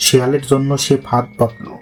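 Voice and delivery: plain reading voice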